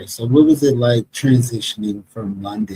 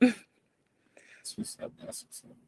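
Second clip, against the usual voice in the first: whisper; 11 semitones higher, 17 dB quieter, much slower than usual. Real, not acted. Interviewer reacts quietly.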